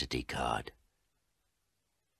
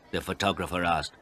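ordinary delivery, nothing marked

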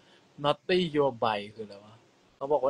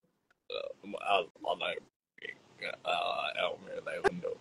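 pressed-down low voice; dropping voice deep; 9 semitones lower, 8 dB quieter, slower than usual. Shown as a demonstration. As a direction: push the voice down low and slow. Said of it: "ทำไมต้องกดเสียงต่ำ".

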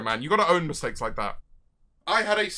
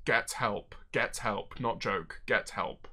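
stunned murmur; 9 semitones lower, 12 dB quieter, slower than usual. Real, not acted. Stunned reaction to dono.

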